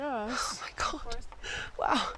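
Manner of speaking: slow breathy whisper; stunned hush; awed whisper. Real, not acted. Awed by her heavy load.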